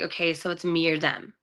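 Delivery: giving in after her agent's argument, quiet and resigned; resigned sigh. Retold or imitated. Sighing, hushed and defeated.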